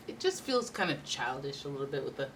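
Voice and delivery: ordinary talk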